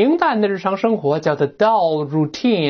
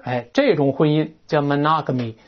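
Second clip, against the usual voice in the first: usual speaking voice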